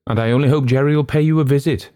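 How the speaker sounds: quick, high, nervous parting shot; nervous man. A narrator's reading. Nervous man calling after the departing old gentleman, nervous. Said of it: "added the nervous man".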